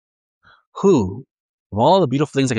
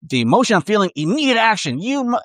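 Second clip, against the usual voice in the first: mock rising outburst, higher and higher; imitating a rash angry reaction; hothead reacting; 9 semitones higher than usual. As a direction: climb in pitch like anger boiling over. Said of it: "That's not being".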